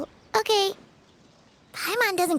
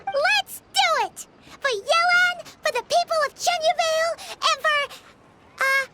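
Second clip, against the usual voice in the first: high-pitched eager battle cry; rally the team with big enthusiasm; rallying cheer; 8 semitones higher than usual. Acted. Paimon pumps up Traveler for the mission, cheering high.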